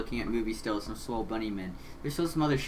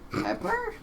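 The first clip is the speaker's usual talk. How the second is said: incredulous. Real, not acted. Echoing in disbelief.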